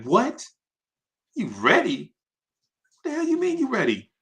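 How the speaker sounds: high, fast disbelief; can't believe what he just heard; incredulous. Retold or imitated. Producers doubting Big is ready after one listen, high and fast.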